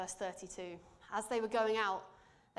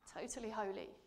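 ordinary talk, nothing marked

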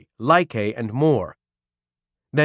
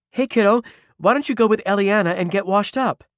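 gentle coaxing; 8 semitones higher, 3 dB louder, faster than usual. Acted. Mari soothes Ruby.

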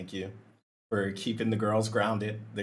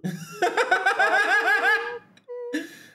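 long, loud laugh pitched very high; shrieking laugh on the edge of tears; high tearful laugh; 5 dB louder than usual. Real, not acted. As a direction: squeal with laughter, close to crying. Reacting to streamer's tearful joke.

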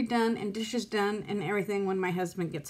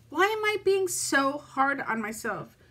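normal talking voice

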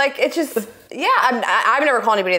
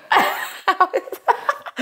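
high burst of laughter; 10 semitones higher than usual. Real, not acted. Laughs at safe word.

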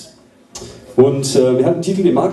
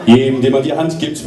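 ordinary talk

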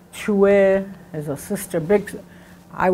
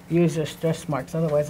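ordinary delivery

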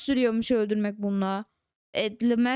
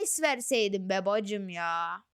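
softer, slower, lower sigh of regret; real letdown, quiet and slow; disappointed mutter; 5 semitones lower, 6 dB quieter than usual. Real, not acted. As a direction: grumble sadly to yourself. Regretting the fruit.

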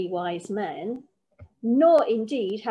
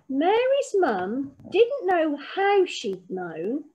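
plain reading voice

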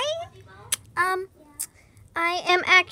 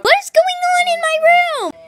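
high, loud what-is-going-on demand; angry room owner; 12 semitones higher, 8 dB louder than usual. Pretend. Owner finding the cats in her room, angry. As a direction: burst in and demand answers.

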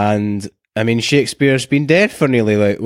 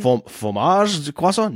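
fake French accent; 5 semitones higher, 3 dB quieter, slower than usual. Mock French word game.

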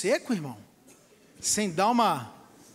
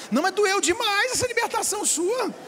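higher, lightly whiny complaint; mild whining sheep; 9 semitones higher than usual. Retold or imitated. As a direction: complain it hurt too much. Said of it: "E ele dizia". (ovelha reclamando, de leve)

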